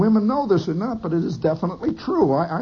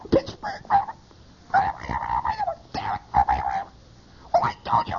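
leaking phone conversation; 7 semitones lower than usual. Retold or imitated. Eavesdropper catches one or two words, imitated crossed-line voices. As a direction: imitate faint voices bleeding through the receiver. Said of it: "you begin to hear a subterranean conversation".

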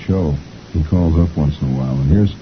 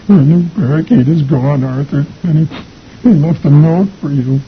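high, broken mock sobbing; crying mother; 10 semitones higher, 4 dB louder than usual. A narrator's reading. Mother breaks the news to Arthur, mock crying. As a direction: wail through put-on tears. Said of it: "the mother's crying".

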